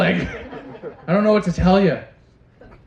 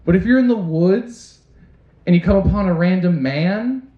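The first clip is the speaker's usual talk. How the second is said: ordinary delivery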